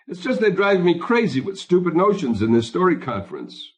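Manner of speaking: high-pitched, worked-up complaint; exasperated excuse. A narrator's reading. Husband excuses himself to his wife, exasperated and high.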